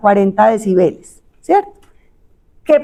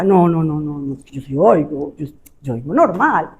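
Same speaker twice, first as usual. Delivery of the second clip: stubborn denial; 8 semitones lower than usual. Acted. Acted denial of hearing loss.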